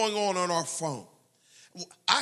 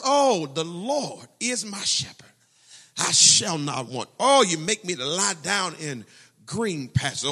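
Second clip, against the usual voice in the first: fervent recitation; 5 dB louder than usual. Acted. Preacher reciting Psalm twenty-three.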